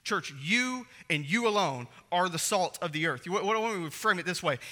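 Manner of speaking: usual speaking voice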